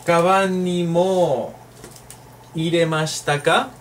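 slow, careful kaban ni mo, rising on ka; slow model sentence. Shown as a demonstration. Teacher reading the example slowly for the class. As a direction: spell it out slowly for learners. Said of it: "Recuérdalo ahora".

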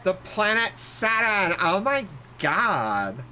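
put-on high, drawn-out Valley Girl gushing; over-the-top Valley Girl accent. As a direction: stretch it out like an exaggerated Valley Girl. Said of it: "that's a very Valley Girl".

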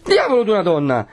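high, vexed outburst; Perpetua's exasperated exclamation. A narrator's reading. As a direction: snap it while pushing someone off. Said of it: "esclamò Perpetua, respingendola". (esclamazione esasperata, acuta)

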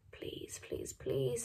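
breathy urgent whisper, nearly silent; pleading whisper. A narrator's reading. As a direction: beg under your breath so no guard hears. Jonas begging at train car.

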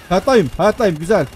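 ordinary talk

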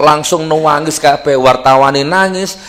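ordinary talk

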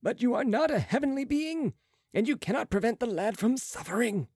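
high-pitched character voice, insistent; Marat challenging. A narrator's reading. Marat doubts Balsamo's power, high insistent voice. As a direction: pitch it up and argue the point.

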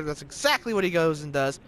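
plain reading voice

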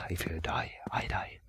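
hushed whispered reading; ominous whisper. Acted. Player reads a creepy note aloud, ominous whisper. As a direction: let the last words sink into a whisper.

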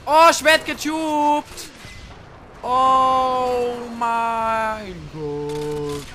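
slow, loud, stretched-out cry; drawn-out shout. Real, not acted. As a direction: wail it out slowly and loudly. Ausruf beim Getubtwerden. (langgezogener lauter Aufschrei)

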